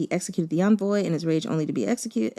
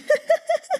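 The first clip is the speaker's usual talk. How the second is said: high, emotional laugh; a real tearful burst of laughter; teary laugh. Real, not acted. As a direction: let out a choked laugh. After emotional reveal.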